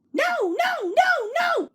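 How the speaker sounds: very high, loud squeaky no no; silly trucks refusing to stop; defiant trucks. A narrator's reading. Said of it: "answered the trucks".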